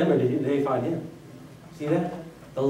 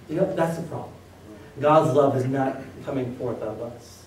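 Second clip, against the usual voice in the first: usual speaking voice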